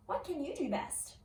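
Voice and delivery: ordinary delivery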